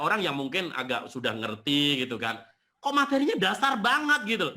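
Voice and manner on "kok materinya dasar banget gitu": high, loud mimicked grumble; mock complaint. Seminar participant grumbling that it is too basic, mock complaint. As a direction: imitate a put-out know-it-all, pitched up.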